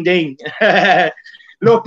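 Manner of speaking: ordinary delivery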